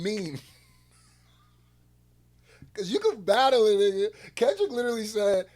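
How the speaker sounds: normal talking voice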